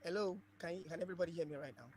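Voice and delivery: very faint, distant voice; like a weak phone line far away; barely audible. Real, not acted. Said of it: "very faintly".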